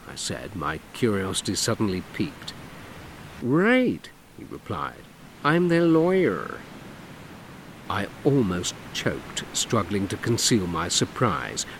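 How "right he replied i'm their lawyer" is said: higher, slow, theatrical flamboyant man. Acted. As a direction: camp it up, lilting and showy. Dougie voiced in story.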